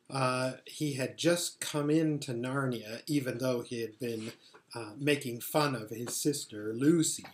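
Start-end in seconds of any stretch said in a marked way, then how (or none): none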